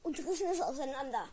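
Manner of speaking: ordinary talk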